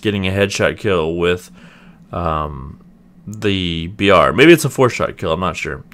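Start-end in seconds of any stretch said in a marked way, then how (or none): none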